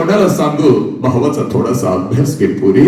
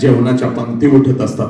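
ordinary talk, nothing marked

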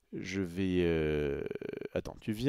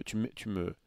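ordinary talk

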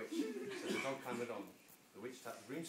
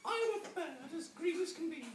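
high chirpy bird voice; high giggly bird asking; 5 dB louder than usual. Acted. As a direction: squawk it like a little green bird. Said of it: "then said".

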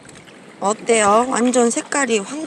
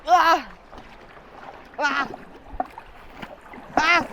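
straining grunt; 5 semitones higher than usual. Real, not acted. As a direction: heave with a groan. Lifting heavy basket.

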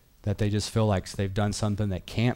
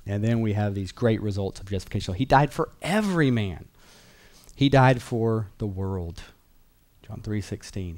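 ordinary delivery

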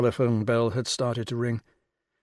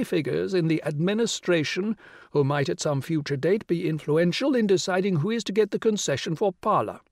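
grand regal lady, pitched high and louder; rich royal voice; 7 semitones higher, 3 dB louder than usual. Acted. The Rani greeting Will on the phone, rich and regal. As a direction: sound like a queen condescending down the phone. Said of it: "the rich royal voice".

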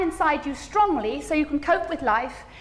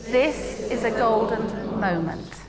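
even slower and lower, savouring each word; calm, slow and low; 6 semitones lower, slower than usual. Shown as a demonstration. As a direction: breathe it out slowly. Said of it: "Can you say that with me?".

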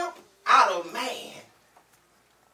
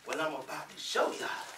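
ordinary talk, nothing marked

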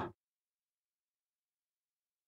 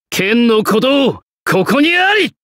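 unleash the final strike with a roar; 必殺技の叫び; climactic battle cry; 7 semitones higher than usual. Acted. Finishing cry of the ultimate technique.

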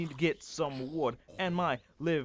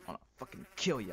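ordinary talk

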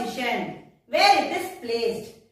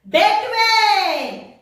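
"Between" called out louder and higher; emphatic answer; 5 semitones higher, 6 dB louder than usual. Shown as a demonstration. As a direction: announce "Between" loudly to the class. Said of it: "Write the spelling".

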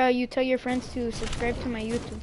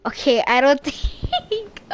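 laughing; 8 dB louder, slower than usual. Real, not acted. Laughing at not understanding chat.

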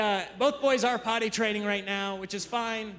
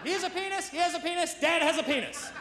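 put-on higher, pointed toddler accusation; accusatory little girl; 10 semitones higher, 4 dB quieter than usual. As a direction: announce it like a tiny accuser. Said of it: "Like a very accusatory way".

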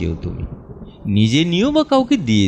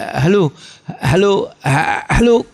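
anxious hello; 7 semitones higher, faster than usual. Acted. Line goes dead mid-call.

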